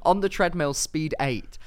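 usual speaking voice

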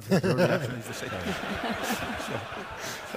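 chuckling as he says it; real laugh breaking into the words. Real, not acted. Laughing at own Scouse story.

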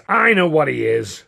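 indignant and forceful; seething. A narrator's reading. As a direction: cut in, seething with anger. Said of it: "Considine seethed".

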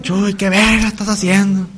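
mock-indignant slow call to Chuy. Friends quoting a line.

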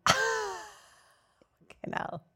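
high, dragged-out laughing curse; laughing disbelief. Real, not acted. Friend laughing at the wasted weekend of worry. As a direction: laugh out the exasperation.

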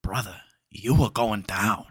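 gruff tough-guy threat; Al Pacino impression. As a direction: say it like a cocky detective. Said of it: "it's a pretty good impression".

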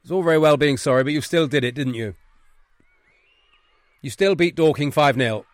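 loud, firm telling-off; scolding headmaster. Acted. Headmaster telling boys off for a thrashing, scolding. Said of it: "One is the Wrexham Headmaster's Office".

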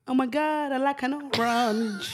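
put-on high, slow, drawling made-up accent; nameless accent. Comic demonstrating a rhyme to friends in a nameless accent. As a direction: do a silly invented accent, dragging out orange. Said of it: "it's a nameless accent".

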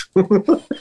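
short burst of laughter at his own joke. Real, not acted. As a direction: laugh it off, a bit sheepish.

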